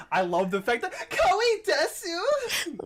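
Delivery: high, loud delighted squeal; gushing excitement. Real, not acted. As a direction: squeal it out with glee. Host gushes over answer.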